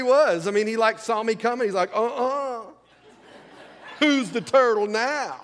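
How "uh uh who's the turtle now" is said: high, slow cocky comeback; defiant mock comeback, drawn out; bowed-up turtle taunt. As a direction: taunt like a puffed-up little turtle. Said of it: "bowed up".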